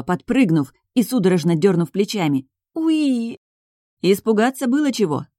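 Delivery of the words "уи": high, drawn-out squeak of fright; a sudden high "ooee" yelp; startled squeal. A narrator's reading. Heroine caught peeking from behind the curtain, startled squeal. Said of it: "аж взвизгнула".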